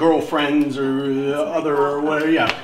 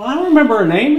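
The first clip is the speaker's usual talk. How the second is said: loud; 10 semitones higher, 10 dB louder, faster than usual. Acted. Breaking up carelessly.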